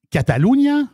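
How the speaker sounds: Catalunya in a Spanish accent, high, lilting up. Shown as a demonstration. Host showing off the region's local name, Spanish accent. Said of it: "c'est mon accent espagnol".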